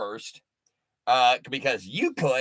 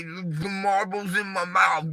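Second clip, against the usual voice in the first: mocking a thick northern accent, mushy like a mouth full of marbles; lightly mocked Boston accent, words swallowed; 4 semitones higher than usual. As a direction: parody a mumbled New York or Boston accent. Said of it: "I hear the way".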